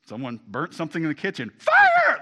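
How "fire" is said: a piercing alarm yell, far higher and louder; alarmed shout. Retold or imitated. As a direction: yell it to warn the house. Warning of kitchen fire.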